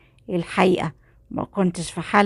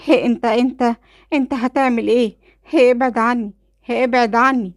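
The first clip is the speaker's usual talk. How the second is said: higher, louder panicked cries; frightened scream; 6 semitones higher, 4 dB louder than usual. Acted. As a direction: shriek in terror as he comes at her. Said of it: "صرخت وقالت بخضة". (صرخة مرعوبة ومفزوعة)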